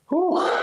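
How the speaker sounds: high breathy whew of relief; high relieved whew. Real, not acted. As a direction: blow out after the set. After hard set.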